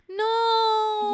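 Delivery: high, loud gasp of disbelief; shocked. Real, not acted. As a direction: react in shock. Shocked reaction to stalker news.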